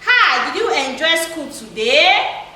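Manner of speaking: yes-or-no question, voice climbing on "today"; rising tone. Shown as a demonstration. Teacher shows pupils a yes-or-no question, rising tone on "today". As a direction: let "today" go up at the end. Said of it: "you use the rising tone".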